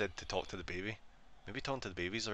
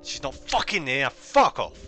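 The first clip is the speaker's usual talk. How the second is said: loud, high-pitched cursing; angry outburst; 6 semitones higher, 13 dB louder, slower than usual. Real, not acted. Player finding nobody there, angry outburst. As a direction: swear at the game, building to a shout. Ghost missing in horror game.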